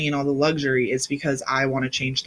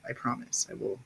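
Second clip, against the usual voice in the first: whispered aside; 4 semitones lower, 13 dB quieter than usual. Real, not acted. Aside promising to find video.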